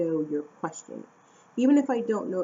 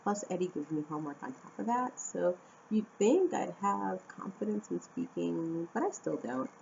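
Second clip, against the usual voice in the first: usual speaking voice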